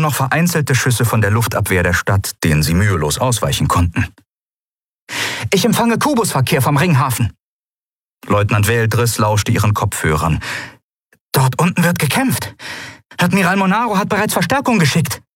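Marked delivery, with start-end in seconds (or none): 5.5-15.2 higher, brisk lieutenant voice; lieutenant briefing the captain; female officer's report. Acted. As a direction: report what the headphones pick up. Lieutenant reports radio traffic.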